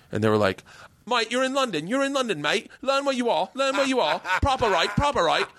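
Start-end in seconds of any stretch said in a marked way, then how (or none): 1.1-5.5 very high, hammed-up London accent; singsong, high-pitched London mockery. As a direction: scold an outsider in caricature Londoner. Imitates Londoners scolding his joke.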